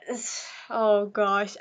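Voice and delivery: ordinary talk, nothing marked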